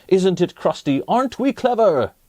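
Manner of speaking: a hint of a proud crowd; mock villager shout. Villagers cheer their baked loaf, mildly proud. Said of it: "the villagers raised a mighty shout".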